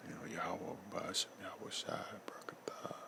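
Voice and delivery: breathless whisper of holy names; barely-voiced plea, calling on the names; whispered prayer. Kneeling over his father, he whispers the Lord's names.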